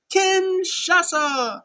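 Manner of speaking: high, loud, drawn-out move call; announcer call. Real, not acted. Vlogger calling the finisher like an announcer, loud and slow. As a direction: belt out the move name slowly like a ring announcer.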